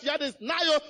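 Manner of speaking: ordinary talk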